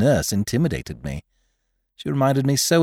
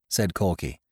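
ordinary delivery, nothing marked

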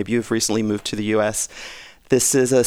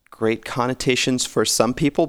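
ordinary talk, nothing marked

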